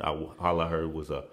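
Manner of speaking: usual speaking voice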